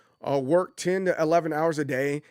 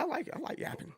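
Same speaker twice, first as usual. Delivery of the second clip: whispered aside; 11 semitones higher, 12 dB quieter than usual. Real, not acted. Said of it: "Yapping is good time".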